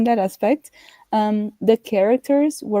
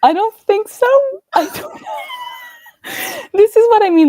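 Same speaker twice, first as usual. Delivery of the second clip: high and louder, laughing; 5 dB louder than usual. Real, not acted. Co-host laughing at forgetting the plots, amused. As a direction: burst out laughing in agreement.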